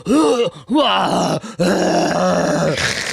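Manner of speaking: high, loud imitated groans; loud straining moans. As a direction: imitate ungodly noises from a cubicle. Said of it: "he was loud".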